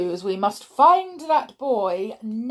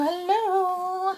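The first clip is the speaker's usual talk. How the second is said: high, playful singsong hello; sing-song greeting; 11 semitones higher than usual. Shown as a demonstration. Greeting young listeners in a high singsong. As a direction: lilt it up like a children's presenter.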